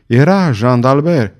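usual speaking voice

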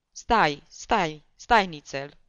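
loud panicky repeated stop; frantic begging. A narrator's reading. The sick comic begs the barber to wait, panicky. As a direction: beg him to wait, frantic.

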